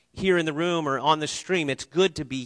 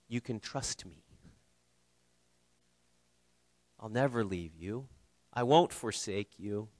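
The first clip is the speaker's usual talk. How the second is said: softer, tender, unhurried reassurance; tender words of Jesus; 6 semitones lower, 7 dB quieter than usual. Acted. Jesus reassuring worn-out listeners, softly and tenderly. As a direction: speak it gently like a promise.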